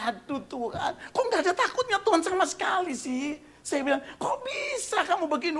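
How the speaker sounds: high, laughing exasperation; laughing disbelief. Retold or imitated. Pastor scolding the host, high laughing disbelief.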